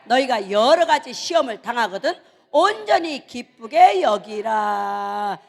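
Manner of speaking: loud, drawn-out scripture chant; full-voiced slow group recitation; leading a recitation. Shown as a demonstration. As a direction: lead the congregation in reciting, stretching the last word long. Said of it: "시작".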